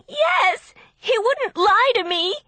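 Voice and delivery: high, loud tearful cry; crying shout. A narrator's reading. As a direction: shout it through tears.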